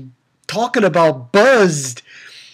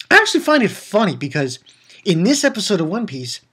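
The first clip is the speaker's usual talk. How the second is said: ordinary talk, nothing marked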